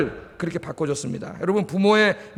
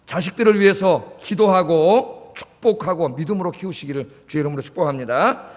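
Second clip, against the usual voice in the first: ordinary talk, nothing marked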